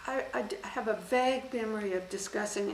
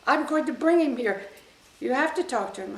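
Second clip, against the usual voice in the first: loud, higher demanding shout; retold angry order; 6 semitones higher, 10 dB louder than usual. Furious general ordering her to meet the prisoner, loud. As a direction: insist angrily, raising the voice. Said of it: "He said".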